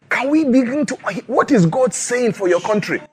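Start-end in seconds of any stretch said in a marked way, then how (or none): none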